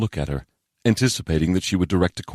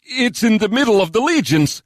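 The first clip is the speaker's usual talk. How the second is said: high, anxious objection; high-pitched nervous subordinate; alarmed protest; 4 dB louder than usual. Acted. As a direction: protest high, dismayed by the order.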